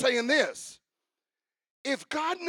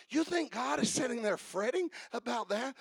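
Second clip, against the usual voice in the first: usual speaking voice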